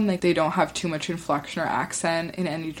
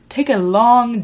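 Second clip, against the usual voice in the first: louder, higher mock instruction; mock voice coach; 4 semitones higher, 7 dB louder than usual. Teacher coaching trainee anchors, mock instruction. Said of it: "classes where they're like".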